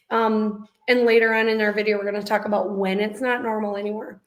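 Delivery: ordinary talk, nothing marked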